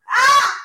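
high loud squeal of delight; delighted shriek. Real, not acted. Squealing with delight at her own soap gossip. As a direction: let out a scandalized squeal. Shriek after calling Lexi a hoe.

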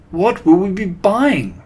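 high, loud questioning; excited chorus demanding an answer; eager cry. A narrator's reading. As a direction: ask as breathlessly keen followers. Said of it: "they cried".

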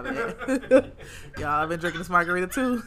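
laughing through an apology; real laughing. Real, not acted. Speaker owning a slip to viewers over a margarita, laughing. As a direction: giggle through the words at your own slip.

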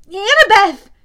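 high, loud pleading yell; Percy yelling at the hunters. A narrator's reading. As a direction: beg them loudly to save her. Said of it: "I yelled".

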